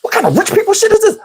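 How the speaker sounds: high, fast, stunned outburst; shocked disbelief. Retold or imitated. Comic recalling his shock at her perfume, stunned. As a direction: squeal it in disbelief. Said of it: "I said".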